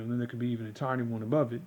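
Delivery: normal talking voice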